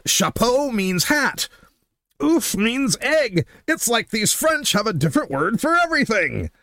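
[name] routine. Retold or imitated. Host retelling an old comedy album routine, mock amazement. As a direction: mimic a comedian marvelling at a foreign language. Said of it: "He has this routine".

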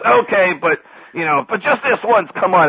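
high, loud mock giving-in; Sam relenting. Sam lets Dewey join the smokers, high loud relenting. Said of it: "Sam goes".

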